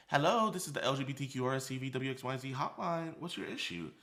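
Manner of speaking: mock hotline operator. Acting the hotline operator answering.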